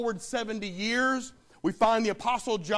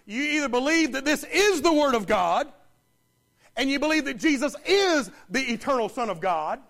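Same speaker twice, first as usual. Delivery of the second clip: higher and louder, pounding each "is"; full-force preaching; 4 semitones higher, 6 dB louder than usual. Acted. Preacher pressing the congregation to decide, fervent and loud.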